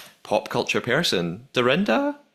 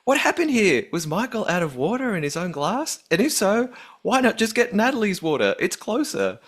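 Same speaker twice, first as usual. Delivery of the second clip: higher-pitched put-on Australian accent; 5 semitones higher than usual. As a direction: read the email out as a lilting Australian. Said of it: "read it in a different accent".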